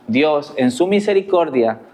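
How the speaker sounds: ordinary delivery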